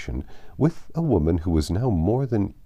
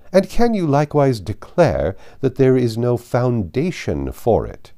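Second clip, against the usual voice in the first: higher and louder, indignant questioning; outraged grand dame; 4 semitones higher, 6 dB louder than usual. Acted. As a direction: demand answers from above. Lady Catherine presses.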